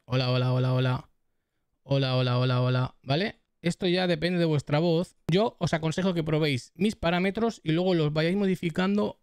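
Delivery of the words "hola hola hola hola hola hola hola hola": low, monotone hola on repeat; prueba de voz monótona. Shown as a demonstration. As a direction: test the mic in a lower, even drone. Mic test comparing EQ settings. (prueba de voz grave y monótona)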